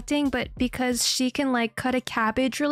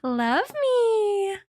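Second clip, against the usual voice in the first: read out high; 8 semitones higher than usual. Retold or imitated. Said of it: "it says".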